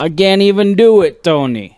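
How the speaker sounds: lightly put-on New York Italian accent. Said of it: "I haven't practiced my accents".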